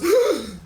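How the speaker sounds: high acted gasp; sharp intake of horror; crowd's shocked gasp. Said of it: "the whole crowd goes".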